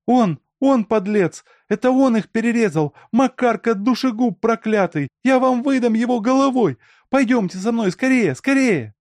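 very high, loud frantic cries; screaming accusation. A narrator's reading. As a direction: shriek the accusation and urge them on. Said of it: "Алёна закричала". (истошный обвиняющий крик)

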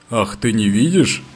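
put-on old captain's mild growl; angry growl. A narrator's reading. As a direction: growl the question, a touch of anger. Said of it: "прорычал старик, не на шутку разозлившись".

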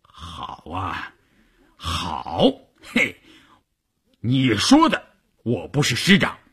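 grind it out, then explode; through gritted teeth. Acted. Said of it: "咬牙切齿地说".

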